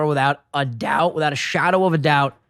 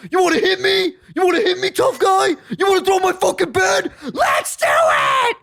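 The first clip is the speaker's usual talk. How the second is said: high, shouted dare, stretched at the end; intimidating mock challenge; 13 semitones higher than usual. [name] daring Ronnie as an intimidation tactic, mock menacing shout. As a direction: goad him into a fight, hammed-up. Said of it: "As an intimidation tactic".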